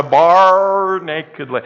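"Bar" long and loud, imitating a Scotsman; drawn-out stress on BAR. Preacher mimicking the Scotsman's emphasis, "Bar" loud and long. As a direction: punch and stretch "Bar". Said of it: "the emphasis on the".

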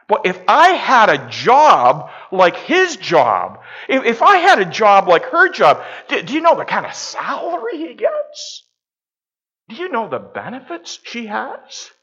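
high, heated mock envy; envious outburst. Preacher acting out coveting others' jobs, high-pitched mock envy. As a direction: work yourself up with jealousy, pitch leaping.

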